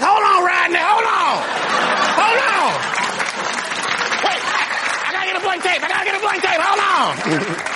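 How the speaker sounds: frantic cameraman. Acted. Bystander yells at Rodney to hold on, frantic and high. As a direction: panic while he hunts for a blank tape. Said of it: "to be like".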